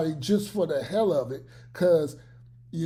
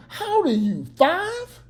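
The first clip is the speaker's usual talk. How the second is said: mocking disbelief; 10 semitones higher than usual. Real, not acted. Mocking a grown man.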